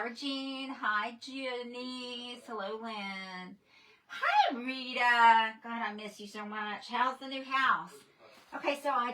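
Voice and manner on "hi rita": excited greeting. Real, not acted. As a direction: greet a dear friend gleefully. Greeting viewer by name.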